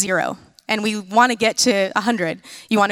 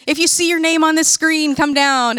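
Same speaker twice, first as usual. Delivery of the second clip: higher-pitched imitation of a camp leader; upbeat announcer summoning players; 6 semitones higher than usual. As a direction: call contestants up from the crowd. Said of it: "a leader comes up and they said".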